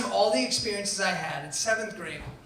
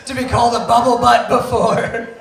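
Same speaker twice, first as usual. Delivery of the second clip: laughs while telling it, louder; laughing; 4 semitones higher, 10 dB louder than usual. Real, not acted. Telling the audience his hurt, laughing loudly. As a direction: laugh through the memory. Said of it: "I had never been so hurt".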